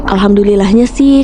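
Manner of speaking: ordinary delivery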